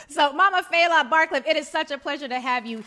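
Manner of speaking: loud, high joyful introduction; excited welcome. Real, not acted. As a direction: announce her name with delight. Host warmly announces honored guest.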